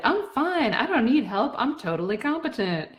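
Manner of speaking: high-pitched, overinsistent "I'm fine" act; insistently fine, starting high and easing down; mock self-sufficient front. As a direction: put on a high, too-eager show of coping. Acting out the front she hides behind.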